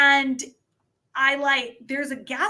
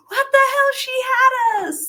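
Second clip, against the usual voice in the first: shocked crowd; 14 semitones higher, 6 dB louder than usual. Retold or imitated. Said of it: "everyone was like".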